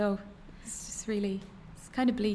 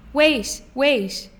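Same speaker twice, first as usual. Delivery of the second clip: higher, louder call to stop him; shouting after someone; 4 semitones higher, 11 dB louder than usual. Acted. Fiona calls after him as he jogs back, shouting. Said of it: "Fiona shouted after me".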